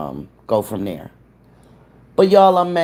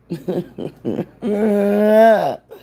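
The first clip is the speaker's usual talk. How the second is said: laughter; 7 semitones higher than usual. Real, not acted. Laughing at her computer mistake.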